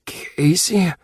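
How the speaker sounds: whispered, barely awake; faint waking whisper. Acted. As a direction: breathe her name as you come round.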